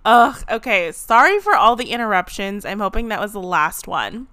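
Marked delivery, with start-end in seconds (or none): 0.0-0.9 disgusted ugh. Retold or imitated.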